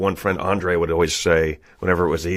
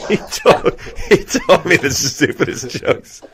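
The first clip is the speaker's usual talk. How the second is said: usual speaking voice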